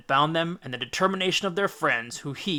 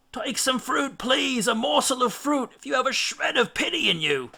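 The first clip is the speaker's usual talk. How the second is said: much higher, pleading whine; begging; 10 semitones higher than usual. A narrator's reading. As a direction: beg for one last morsel. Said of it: "begged Eurylochus".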